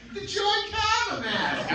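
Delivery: very high, stretched mock New England accent; thick Boston-area accent. Woman auditioning, high loud imitated Boston-area accent. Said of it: "someone's got an accent".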